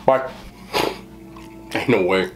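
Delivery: ordinary talk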